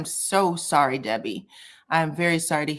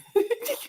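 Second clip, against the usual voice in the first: high giggle. Real, not acted. Amused by a chat comment.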